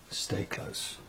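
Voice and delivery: unvoiced urgent whisper. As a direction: breathe it, don't voice it. Creeping in dark.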